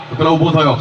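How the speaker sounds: ordinary talk, nothing marked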